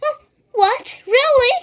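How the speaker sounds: very high, loud hopeful cry; surprised cry. A narrator's reading. Wilbur hearing he won't die, crying out. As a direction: cry out in disbelief. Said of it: "cried Wilbur".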